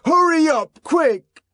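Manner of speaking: enraged man standing over the woman, shouting; furious shout. Acted. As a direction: drive her out with one shout. John driving Lenina out.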